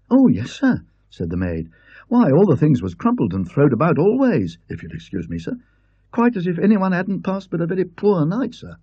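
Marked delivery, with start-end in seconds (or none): 0.1-0.8 put-on lightly raised, eager young maid; a bright housemaid offering help, a little louder; young maid asking the guest politely. Maid voiced by the narrator. 2.1-8.8 put-on lightly raised, eager young maid; young maid asking the guest politely. Maid voiced by the narrator.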